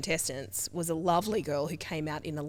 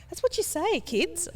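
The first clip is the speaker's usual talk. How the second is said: put-on lightly higher, teasing mum turning it on the kids; 11 semitones higher than usual. Mum teasing her kids, higher voice. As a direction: toss it back at the kids in a high mock voice. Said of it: "I go".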